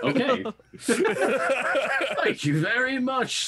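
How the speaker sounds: high put-on voice near laughter; Shlum, grateful. Driver thanking his small helper, high grateful voice. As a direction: gush thanks in a high character voice.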